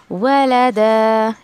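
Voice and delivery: वलद stretched very slowly, the ending held; slow pausal recitation of वलद; stop on वलद. Shown as a demonstration. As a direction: hold वलद long as you stop on it. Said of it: "इस तरीके से आपको जो है वो रुकना है".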